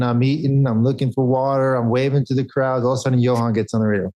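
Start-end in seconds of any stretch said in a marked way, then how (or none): none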